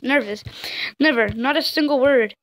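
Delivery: usual speaking voice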